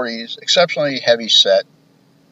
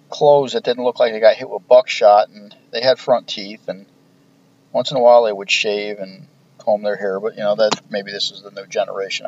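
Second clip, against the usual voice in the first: normal talking voice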